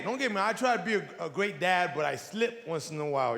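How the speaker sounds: ordinary talk, nothing marked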